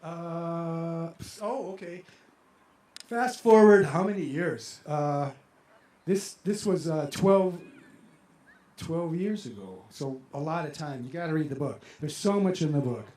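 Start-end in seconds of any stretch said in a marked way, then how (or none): none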